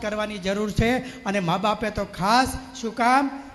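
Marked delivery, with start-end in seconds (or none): none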